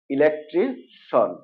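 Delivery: "ইলেকট্রিশন" slowly, ending in one syllable shon; correct SHON ending. Shown as a demonstration. Teacher gives the correct pronunciation, "ইলেকট্রিশন" ending in shon. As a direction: draw out "ইলেকট্রিশন" as e-lek-tri, then shon. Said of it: "এটা হবে".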